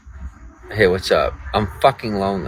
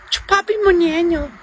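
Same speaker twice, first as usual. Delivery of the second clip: meme voice; 5 dB louder than usual. Pretend. Meme catchphrase reading chat.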